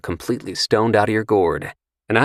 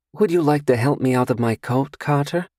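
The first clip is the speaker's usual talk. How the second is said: higher, light feminine voice; coy and sure of herself; seductive stranger; 7 semitones higher than usual. Acted. Seductive stranger asks Carter about his drink, coy. Female character voice.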